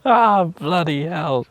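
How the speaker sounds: loud, slow groan of dismay; drawn-out loud "Ah, bloody hell"; exasperated exclamation. Real, not acted. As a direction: let it out at the ruined bed. Exasperated at garden damage.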